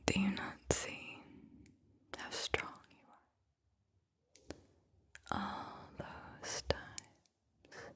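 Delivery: slow whispered verse with long pauses between phrases; whispered poem reading. Acted. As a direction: whisper the poem phrase by phrase, letting each one settle. Reads a second poem aloud.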